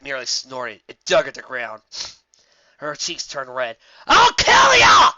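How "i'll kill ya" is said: high, loud, slow screaming threat; furious shout. Acted. Applejack screaming vengeance at the dragon, furious. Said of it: "she shouted".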